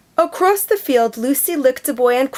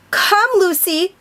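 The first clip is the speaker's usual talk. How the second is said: put-on boy calling his dog back across the field, high call; 8 semitones higher than usual. A narrator's reading. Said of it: "called Bash".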